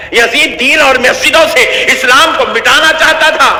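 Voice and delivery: high, impassioned preacher's cry; preaching at full force. Acted. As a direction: thunder it out to the congregation. Fiery sermon on Husain's martyrdom.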